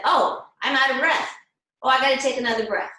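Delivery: mock "oh!" of discovery; sudden realization. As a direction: act out suddenly catching on, quickly. Acts out student noticing breath ran out.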